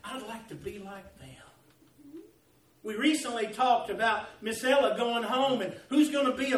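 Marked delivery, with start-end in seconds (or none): 0.0-1.3 very soft, wishful tone; quiet admiration. Acted. Churchgoers admiring other Christians, quiet wishful tone. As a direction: say it hushed, as if privately envying. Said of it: "you say things like this".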